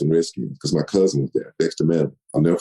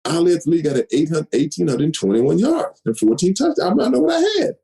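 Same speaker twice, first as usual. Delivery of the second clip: indignant insisting; 11 semitones higher than usual. Retold or imitated.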